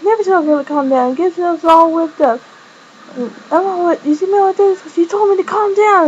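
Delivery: playfully huffy retelling; mock indignation. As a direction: act out an indignant comeback, pitch climbing. Acted indignant reply, all whipped up.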